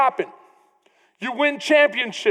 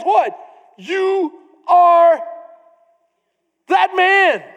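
loud, ringing accusation; prophet's accusation; 5 semitones higher, 6 dB louder, much slower than usual. Acted. Prophet Nathan accusing King David, loud and ringing. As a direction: thunder it at the king.

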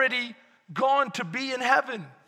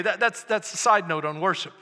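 normal talking voice

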